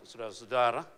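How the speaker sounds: a touch of a stiff, formal pastor; lightly stiff pulpit voice. Shown as a demonstration. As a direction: put on a prim preacher's address. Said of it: "mulai agak jaim gitu".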